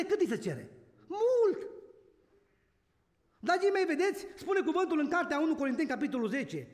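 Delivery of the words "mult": Mult shouted high and loud; emphatic. Real, not acted. Scene: preacher answers his own question to the church, emphatic. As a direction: hammer the word Mult.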